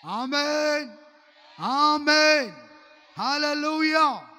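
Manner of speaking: loud, ringing amens and hallelujah; shouted amen. Real, not acted. Pastor rousing the congregation to answer, loud shout. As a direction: shout it out to rouse the church. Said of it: "గట్టిగా చెప్పండి ఆమేనని".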